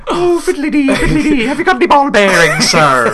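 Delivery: high, hammed-up mock Irish accent, laughing; bad Irish accent. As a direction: overdo a terrible stage-Irish voice. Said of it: "Tommy Lee Jones in the worst Irish accent ever".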